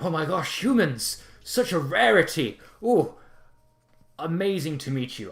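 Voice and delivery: high, loud, effusive host; delighted castle lord. Acted. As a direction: gush grandly over rare guests. Voices Lord Vandemon greeting guests.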